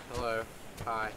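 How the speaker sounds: ordinary talk, nothing marked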